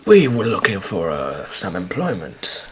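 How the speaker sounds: low, soft, halting character voice; reserved job seeker. Acted. Adventurer asking the merchant for work, quiet and reserved. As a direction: ask for work quietly, searching for words.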